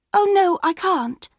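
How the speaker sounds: put-on high, loud worried child; a little girl refusing. A narrator's reading.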